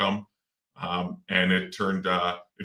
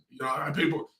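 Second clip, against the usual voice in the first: usual speaking voice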